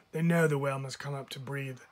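ordinary delivery, nothing marked

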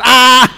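short loud "ah" yell; acted scream. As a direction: let out a sudden comic scream. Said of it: "porque voy a gritar".

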